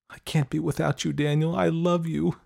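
high, tearful pleading; holding back tears. A narrator's reading. Girl confessing love to boyfriend, holding back tears. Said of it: "holding back the tears".

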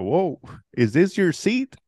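imitated passenger's slow high surprise; surprised passenger. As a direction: stretch it out like a stunned stranger.

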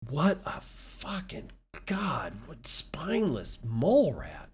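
slow, unvoiced-sounding whispered contempt; disgusted whisper. Real, not acted. Podcaster cursing a spineless executive, in a disgusted whisper. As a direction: whisper it slowly, dragging out the disgust.

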